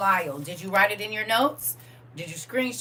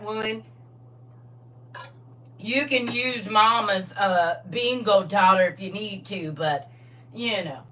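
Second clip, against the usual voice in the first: put-on country Southern accent; 4 dB louder than usual. Teacher waiting on students to get ready, country Southern accent. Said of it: "if you talk like that".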